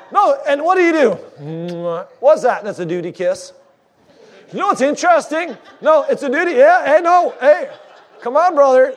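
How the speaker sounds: high, fervent preacher's call to the congregation; preaching at full force. Acted. Pastor urging married couples to kiss, in a fervent preaching voice. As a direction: lift it up and press the married couples.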